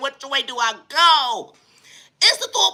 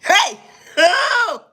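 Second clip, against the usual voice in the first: high, loud panicked cry, slow and drawn out; terrified cry; 6 semitones higher, 4 dB louder, much slower than usual. Acted. Screaming in fear as the dog runs up on him. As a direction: wail it with hands up.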